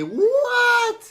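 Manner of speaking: high-pitched squeal of disbelief; shocked shriek. Real, not acted. YouTuber reacting to superfast cubers, shocked shriek. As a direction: leap up into a squeaky, stretched cry.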